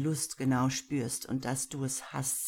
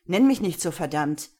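angry snarl; 10 dB louder than usual. A narrator's reading. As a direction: snap back hard, hitting the first words. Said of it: "fauchte Alex wieder". (wütend fauchend)

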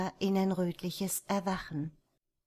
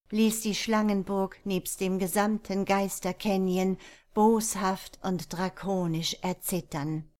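plain reading voice

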